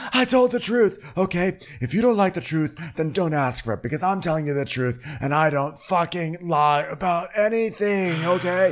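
high-pitched insistent protest; mock defiant rant. Lisa insists to Heather she told the truth, high mock rant. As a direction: act out heated self-justification. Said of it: "when she's screaming".

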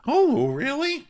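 put-on high little-boy surprise; mock childish surprise. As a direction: act amazed like a small kid. Imitating Eddie surprised.

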